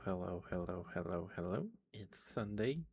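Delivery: normal talking voice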